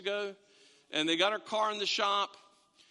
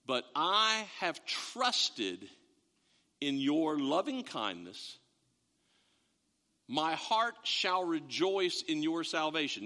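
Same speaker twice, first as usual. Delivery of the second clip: scripture reading; 5 semitones lower, slower than usual. Acted.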